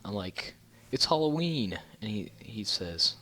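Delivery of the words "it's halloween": higher, louder incredulous quote; mock-indignant protest, pitched well up; retold protest. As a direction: imitate stating the obvious to someone clueless. Retold protest to the old man.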